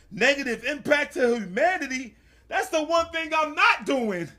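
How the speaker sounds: loud, high-pitched mock outrage; hammed-up shouted self-defence, every word punched; indignant outburst. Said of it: "made me really turn up".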